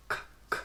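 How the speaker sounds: "K" with no voice at all; isolated "k" bursts, just air; voiceless K sound. Shown as a demonstration. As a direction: release "k" from the back of the mouth, voiceless. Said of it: "No voice".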